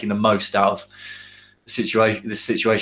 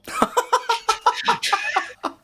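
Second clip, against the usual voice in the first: big laugh. Real, not acted. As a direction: crack up at the joke. Laughing at Bill Bailey joke.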